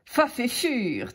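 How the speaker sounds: talking through a mouthful, slow; mouth full. Acted. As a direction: mumble round the food. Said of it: "la bouche pleine".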